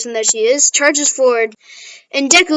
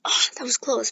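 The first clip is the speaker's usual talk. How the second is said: much softer relieved sigh of words; 10 dB quieter than usual. Retold or imitated. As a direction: let out quiet relief. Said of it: "And Deku's like".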